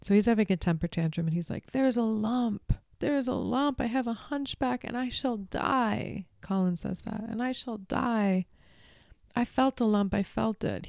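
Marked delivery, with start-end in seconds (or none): 1.7-6.3 higher, slow, mock-tearful whine; imitating sobbing boy. Retelling Colin's tantrum in a higher mock-tearful voice. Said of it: "He's sobbing". 7.2-10.9 imitating sobbing boy. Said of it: "He's sobbing".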